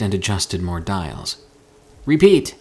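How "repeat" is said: loud, high, authoritative order; commanding. A narrator's reading. Mika demands a reply by radio, with authority. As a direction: project firmly into the microphone. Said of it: "commanded with authority into the microphone".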